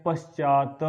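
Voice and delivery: पश्चात with a vowel after final t; पश्चात drawn out to an open "ta"; common mispronunciation. Shown as a demonstration. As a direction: say पश्चात as "pash-chaa-ta". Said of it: "ये लिख देते हैं".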